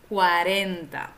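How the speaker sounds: cuarenta with a falling end, oral enta; model cuarenta. Shown as a demonstration. Teacher models cuarenta for students to repeat. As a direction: say cuarenta for repetition. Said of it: "Repite después de mí".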